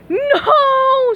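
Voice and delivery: high frantic scream. Acted. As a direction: scream it at full pitch. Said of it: "screamed Mindy".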